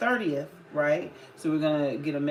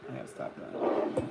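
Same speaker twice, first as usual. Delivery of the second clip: whispered aside; 3 dB quieter than usual. Real, not acted.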